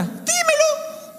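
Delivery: very high drawn-out demand; mock divine demand. God demanding Job answer, drawn out. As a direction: stretch it out, teasing. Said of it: "Lo voy a leer tal cual".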